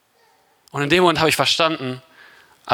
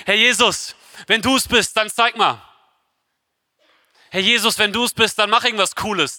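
higher-pitched, casual mock plea; mock vague request; 6 semitones higher than usual. Imagined Peter asking Jesus for just anything, mock casual. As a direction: toss it off as a naive, woolly prayer.

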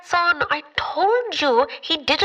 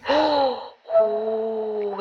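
gasp; 3 semitones lower than usual. Acted. Sudden realisation mid-conversation.

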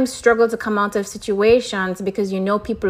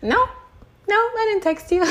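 higher-pitched smug denial, voicing him; 13 semitones higher than usual. Twin flame answers her at the door, smug. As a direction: play him smugly pleased with himself, pitched up. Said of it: "with this smug face".

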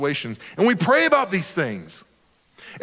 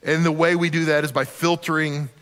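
ordinary talk, nothing marked

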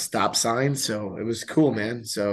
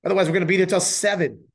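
louder, higher and faster in exasperation; raised exasperated voice; 8 semitones higher, 7 dB louder, faster than usual. Real, not acted. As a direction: blurt it out, fed up. Complaining about parents on floor.

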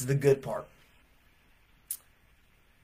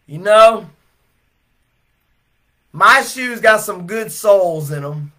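amazement; 2 semitones higher, 7 dB louder than usual. Retold or imitated. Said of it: "he says with amazement".

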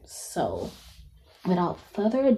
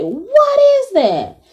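amazed; 13 semitones higher, 6 dB louder, slower than usual. Retold or imitated. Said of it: "I was like".

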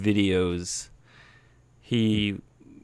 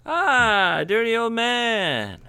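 playful hail; 12 semitones higher than usual. Pretend. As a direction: greet a regular in a high, playful singsong. Hails a listener by nickname.

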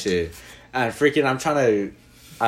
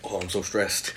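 mock stressed sigh; 7 semitones lower, 8 dB quieter than usual. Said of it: "When they're just like".

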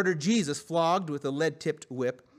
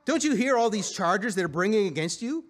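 higher-pitched, insistent demand; demanding question; 6 semitones higher, 3 dB louder than usual. A narrator's reading. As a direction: press him for an answer. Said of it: "Pilate demanded".

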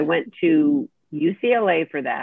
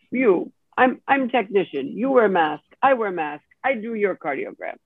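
light imitation of a Russian accent, a bit higher; 4 semitones higher than usual. Technician offering help, said in a mild imitated Russian accent. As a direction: lightly mimic an older Russian man's accent. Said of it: "an older gentleman with a heavy Russian accent".

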